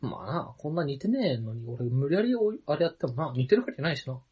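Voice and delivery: low, soft resigned admission; deflated giving up. Real, not acted. Comic admitting to partner they are not alike, deflated. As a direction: drop right down and concede defeat. Gives up the mock argument.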